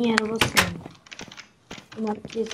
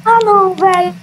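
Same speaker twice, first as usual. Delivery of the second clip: loud, high dismayed cry; panicking gamer; 6 semitones higher, 5 dB louder than usual. Real, not acted. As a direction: wail as everything goes wrong. Attacked again in game.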